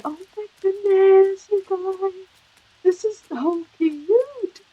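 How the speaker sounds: high, slow gushing squeal. Real, not acted. Player gushing to viewers over a cute scene, high cooing. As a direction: coo it out high and slow, overwhelmed by cuteness.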